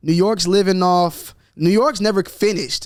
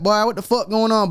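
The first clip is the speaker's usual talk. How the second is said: higher, country Atlanta slang impression; 5 semitones higher than usual. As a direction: toss it off like a local Atlanta guy. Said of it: "out in Atlanta, they like".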